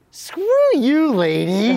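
high, loud put-on kid voice; defiant kid. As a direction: sass the grown-up as a child, hammed-up. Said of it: "expecting the kids to be like".

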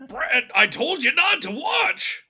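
scolding celebrity impression, shouted high; Seth Rogen. Friend's silhouette in the doorway scolding Brad, Seth Rogen voice. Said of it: "he's doing the Seth Rogen voice".

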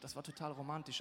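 ordinary delivery

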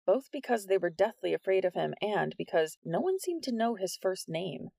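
ordinary delivery

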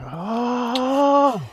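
high, drawn-out mock scream; imitated scream. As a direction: imitate a long high wail. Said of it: "Just screaming".

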